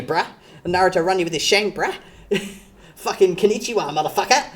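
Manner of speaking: loud hammed-up Australian bogan, rushed; bogan Aussie accent. Streamer plays an Aussie weeb bogan threatening someone, loud hammed-up accent. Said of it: "We gotta be like".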